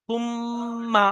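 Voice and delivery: সুম্মা with the doubled ম held as a long nasal hum; held nasal ghunna. Shown as a demonstration. Teacher modelling ghunna on মিম for students. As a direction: hum the ম্ম of সুম্মা through the nose before opening to মা. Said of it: "সর্বাবস্থায় একালি পরিমাণ গুণনা হবে".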